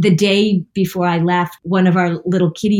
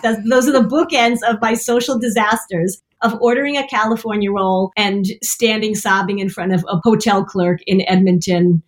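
usual speaking voice

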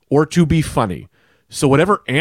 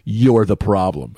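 slow, drawn-out, lower accusation; retold accusation; 5 semitones lower, slower than usual. Comedian confronting the front row, imitated slow accusation. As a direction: stretch each word to single someone out.

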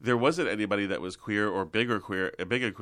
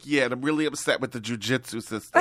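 lightly voiced comic mother, a bit higher; a hint of an upset mom; Italian mom; 4 semitones higher, 4 dB louder than usual. Mom upset about the news, lightly voiced Italian mom. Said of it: "She's like".

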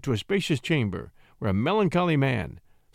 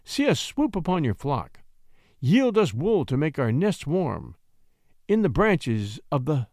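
high, lilting verse recital; measured lines of pastoral poetry; poem recitation; 5 semitones higher than usual. Acted. Shepherd recites his verses.

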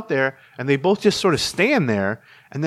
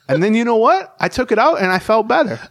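proud quote; 8 semitones higher, 4 dB louder than usual. Retold or imitated. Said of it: "this is Gray talking".

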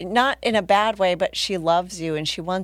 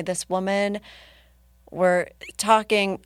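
usual speaking voice

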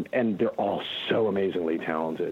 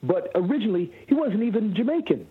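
early Hermes voice; 10 semitones higher than usual. Shown as a demonstration. Telling the hosts Hermes' origins in the higher voice. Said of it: "he sounded something like this".

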